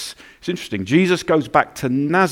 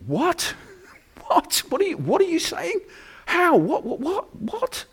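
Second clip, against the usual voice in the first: high, disbelieving what-what-what, much higher; Joseph stunned. Acted. Joseph reacting to Mary's news, high-pitched stunned disbelief. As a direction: Joseph shocked, stammering in disbelief. Joseph hearing Mary is pregnant.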